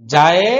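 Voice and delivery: জায়ে stretched loud for four counts; long madd. Shown as a demonstration. Teacher showing the class the four-alif stretch. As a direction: hold the vowel in জায়ে, strong and long. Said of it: "চার আলিফ টান হবে".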